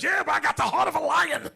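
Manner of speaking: high, loud bragging; boastful brother. Retold or imitated. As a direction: puff up your chest. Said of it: "He says".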